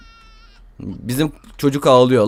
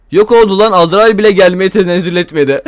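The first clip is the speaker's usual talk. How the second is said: louder, higher outburst; raised incredulous voice; stunned; 5 semitones higher, 5 dB louder than usual. Real, not acted. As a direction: disbelief she just vanished. Wife vanished, no reaper.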